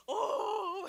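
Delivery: high, drawn-out mock wail; imitated wail. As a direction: imitate a long, high sob without words. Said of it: "통곡이 너무 심하게 통곡을 하는 거야".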